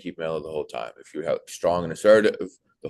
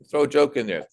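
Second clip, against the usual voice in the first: ordinary talk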